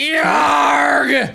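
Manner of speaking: high, loud charging yell; war cry. Pretend. Gamer yelling a war cry while charging. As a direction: bellow as you charge.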